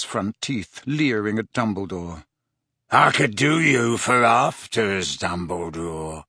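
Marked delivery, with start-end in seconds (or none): 2.9-6.2 low, slow, relishing rasp; savouring werewolf. Acted. Werewolf savouring the thought of blood, low and slow. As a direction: drool over the word delicious. Greyback leering.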